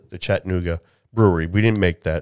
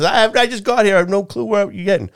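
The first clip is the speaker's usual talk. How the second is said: higher, lightly voiced bewildered customer; confused newcomer; 12 semitones higher, 4 dB louder than usual. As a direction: protest that you just arrived. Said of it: "People behind us".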